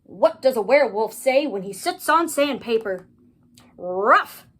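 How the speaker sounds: loud joke set-up, punched punchline; joking young ghoul. A narrator's reading. Ghoul telling classmates a riddle, loud and jokey. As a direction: deliver a riddle with a big punchline.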